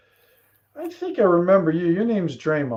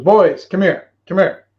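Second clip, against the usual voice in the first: louder call across the yard; calling the boys; 4 semitones higher, 8 dB louder than usual. Acted. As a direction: summon the boys over, raised voice. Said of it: "He goes".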